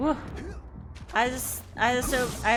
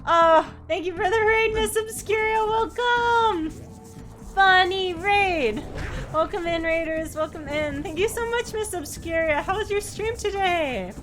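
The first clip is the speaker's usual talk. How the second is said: high bubbly streamer greeting; cute anime streamer; 7 semitones higher, 4 dB louder than usual. Acted. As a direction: squeal a bright welcome to raiders.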